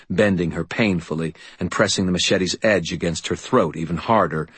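ordinary delivery

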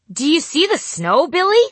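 high, loud calling out. Retold or imitated. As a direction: call across the room. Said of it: "my grandma called out".

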